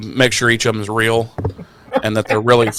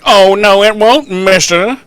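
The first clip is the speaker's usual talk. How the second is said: tough guy voice; 7 semitones higher, 5 dB louder than usual. Retold or imitated. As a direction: play Angel as a 1930s tough guy. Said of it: "Angel's like".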